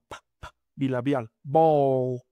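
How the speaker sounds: "Bowl" with a popping b; explosive b demo. Shown as a demonstration. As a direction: pop the lips on the b in "Bowl". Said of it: "Esta B es explosiva". ("Bowl" con B explosiva)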